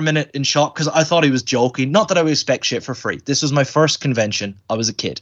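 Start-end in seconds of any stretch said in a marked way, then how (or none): none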